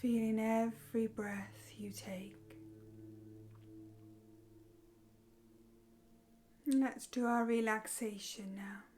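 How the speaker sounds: soft, unhurried meditation voice with long pauses; guided meditation. Acted. Meditation teacher leading listeners through breathing and relaxation, soft guided meditation.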